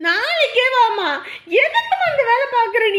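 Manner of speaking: shouted protest. Acted. Daughter shouting at her mother over tomorrow's visit, high loud protest. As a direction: yell back in disbelief.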